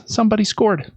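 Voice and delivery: usual speaking voice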